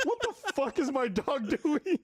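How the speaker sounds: high, slowed, laughing bewilderment; cracking up while asking; laughing disbelief. Retold or imitated. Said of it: "I'm like".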